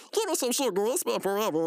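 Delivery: high put-on gushing; hug-happy brother. Brother reunited with an old friend, high mock gushing. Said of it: "talking to Mordecai and he said".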